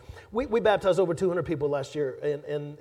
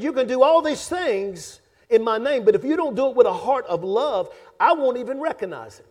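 stern preaching; 5 semitones higher, 7 dB louder than usual. Acted. Preacher voicing Jesus' warning.

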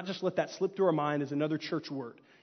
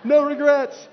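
much louder and higher, blaring; intercom pilot; 8 semitones higher, 10 dB louder than usual. Retold or imitated. Pilot on the intercom to passengers, loud and high. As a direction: announce it over the plane's speakers. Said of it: "gets on the intercom and says".

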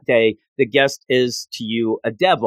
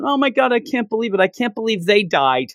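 high-pitched imitated kid blurting; excited disbelief; 6 semitones higher than usual. Host imitates the kid spilling a spoiler, high excited disbelief. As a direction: gush it in mock shock, pitched up.

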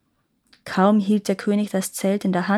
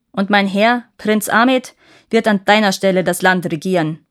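louder, relentless furious gnome voice; angry gnome yelling; 6 dB louder than usual. A narrator's reading. Gnome banishing the king from the city, yelling furiously. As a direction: hammer the verdict home without letting up. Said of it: "schrie der Gnom ihn an".

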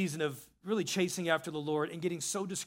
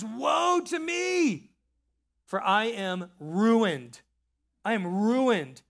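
anguished cry; 3 semitones higher, 5 dB louder, much slower than usual. Acted. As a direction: cry it out as if undone. Pastor acting Isaiah's cry of despair.

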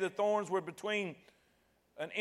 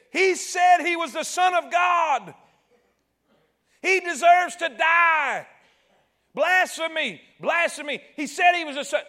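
higher, louder furious shouting; angry mob shout; 8 semitones higher, 8 dB louder than usual. Retold or imitated. Priests shouting blasphemy at Pilate, furious shout. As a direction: yell the accusation like a raging crowd. Enraged priests accuse Jesus.

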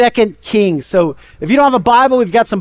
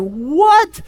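mock shock; 6 semitones higher than usual. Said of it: "and he's like".

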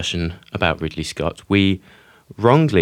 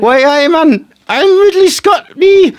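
Geordie Ridley Scott, squeaky, high and loud; shrieking cartoon Geordie impression; over-the-top Geordie accent; 10 dB louder than usual. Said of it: "our impression of Ridley Scott".

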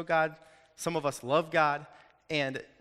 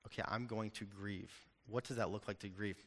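quiet resolve; 5 semitones lower, 12 dB quieter than usual. Retold or imitated. Retelling his private resolve to grieve.

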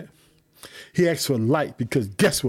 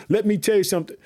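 ordinary talk

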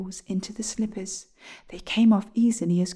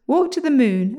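higher, loud command, kept brisk; 5 semitones higher, 7 dB louder than usual. A narrator's reading. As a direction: order the slippers on their way. William orders the slippers off.

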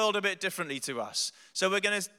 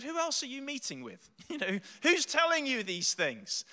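suspicious; 8 semitones higher than usual. Retold or imitated. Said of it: "I got suspicious".

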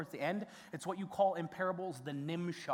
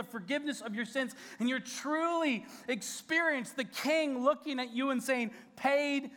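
raised, louder pleading preacher voice; fervent preaching; 7 semitones higher, 4 dB louder than usual. Acted. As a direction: plead with the congregation, full force. Pastor preaches at full force.

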